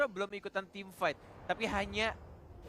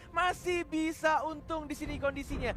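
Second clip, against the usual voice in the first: high, loud excited play-by-play; fighting game announcer; 10 semitones higher, 6 dB louder than usual. Acted. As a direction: build the call to a peak. Caster calls a teamfight.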